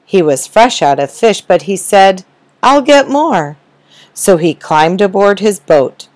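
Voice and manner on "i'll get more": cheerful captain. Acted. Captain Mike voiced.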